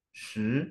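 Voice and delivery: "Shi" climbing after a low syllable; rising second tone. Shown as a demonstration. Teacher saves the rise for ten, "Shi" going up. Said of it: "naiknya itu baru di angka sepuluhnya".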